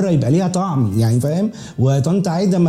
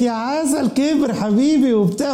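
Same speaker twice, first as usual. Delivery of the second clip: lightly voiced doting mother, higher; mock doting mother; 8 semitones higher, slower than usual. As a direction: coo like a proud mother. Mother cooing over grown son.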